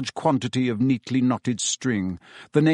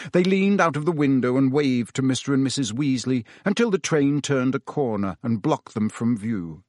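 plain reading voice

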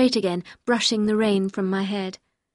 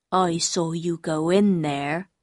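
slow, low, dim-witted man; low, plodding voice of a childlike man; simple-minded man; 4 semitones lower, slower than usual. Acted. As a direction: speak slowly as a simple fisherman.

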